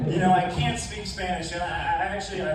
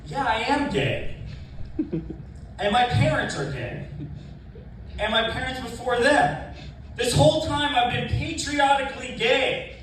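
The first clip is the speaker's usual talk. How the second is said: higher, louder, a hammed-up proud declaration through laughter; proud mock boast; 9 semitones higher, 4 dB louder than usual. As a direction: proclaim it with naive pride, laughing. Mock quote of own proud self.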